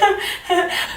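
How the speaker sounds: short, high-pitched awkward giggle; nervous laugh. Retold or imitated. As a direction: imitate your own nervous little laugh. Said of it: "when I get nervous, I laugh".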